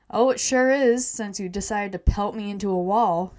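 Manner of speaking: pointed, aggrieved sarcasm; sarcastic. Acted. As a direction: lean hard on it with mock agreement. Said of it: "Jerry says sarcastically".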